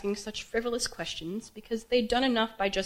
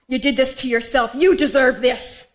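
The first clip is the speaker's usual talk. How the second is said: higher, loud, accusing scream; screaming mother; 5 semitones higher, 9 dB louder than usual. Retold or imitated. Daughter acts out her mother blaming her, screaming. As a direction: scream the blame, building to the end. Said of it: "my mother's voice screaming at me".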